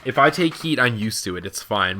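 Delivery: ordinary talk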